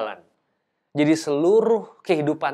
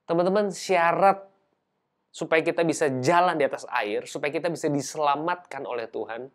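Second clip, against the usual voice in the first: ordinary delivery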